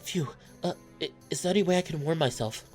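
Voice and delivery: high, stuttering from the cold; shivering goat. Acted. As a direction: stammer it high, as if trembling with cold. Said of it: "the way you shivering".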